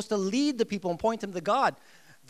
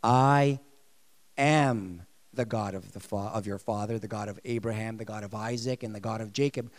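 God speaking present tense; 8 semitones lower than usual. Retold or imitated. Preacher voicing God correcting tense, deep and solemn. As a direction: intone it as God himself, now. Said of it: "God is saying this".

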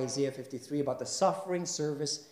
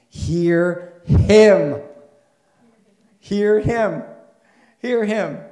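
loud, high preaching climax; full-force preaching; 6 semitones higher, 9 dB louder than usual. Acted. Pastor preaching loudly to the congregation, full force. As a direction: drive the passage home with full voice.

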